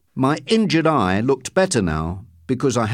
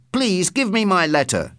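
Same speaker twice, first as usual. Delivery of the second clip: higher, insistent demand; loud, professorial; 6 semitones higher than usual. A narrator's reading. Man playing professor demands his letter from postmistress, mildly loud. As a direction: press the demand as the professor, slightly raised. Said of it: "I said loudly".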